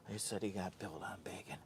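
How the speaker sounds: unvoiced whisper aside to the viewers. Real, not acted. Vlogger whispers aside to viewers about Pops, whisper. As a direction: whisper it behind his back.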